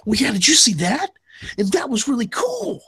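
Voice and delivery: gushing fanboy. Retold or imitated. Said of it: "we're just like".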